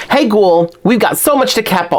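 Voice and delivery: high-pitched attempt at a Brooklyn accent; Claudine. Doll fan reading the blurb as the character, Brooklyn accent. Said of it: "kinda like a Brooklyn accent".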